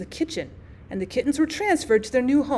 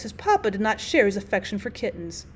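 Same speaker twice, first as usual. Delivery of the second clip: ordinary delivery, nothing marked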